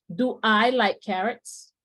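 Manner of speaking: each English word set apart, slowly; slow model sentence. Shown as a demonstration. Teacher reading the example question to the class, slowly. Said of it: "Aquí trabajamos el signo de interrogación".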